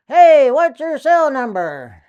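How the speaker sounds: loud, slow call upward; yelling back. Retold or imitated. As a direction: shout up to the man on the tower. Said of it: "So I yelled back".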